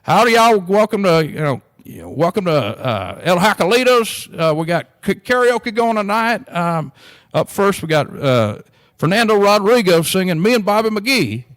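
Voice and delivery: loud, high, drawn-out Texas emcee voice; country karaoke announcer. Retold or imitated. Restaurant emcee announcing karaoke to the room, loud hammy Texas drawl. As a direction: play a hammy small-town emcee hyping the crowd. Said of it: "this old boy gets up there and goes".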